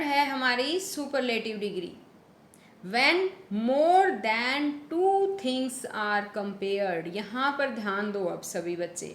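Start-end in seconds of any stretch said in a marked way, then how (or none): 2.8-7.1 very slow, word by word, for the class; slow, careful dictation with more stressed. Shown as a demonstration. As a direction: spell it out slowly for students to copy. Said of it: "teacher dictating slowly".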